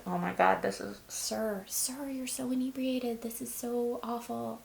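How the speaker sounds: high, drawn-out mock pity; retold amused embarrassment, stretched out; amused disbelief. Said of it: "I'm just laughing".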